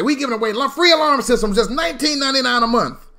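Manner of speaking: high, loud hawker's spiel; alarm salesman pitch. Retold or imitated. Imitating alarm people offering a deal, hyped-up sales pitch. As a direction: sell it hard, like a pushy promoter.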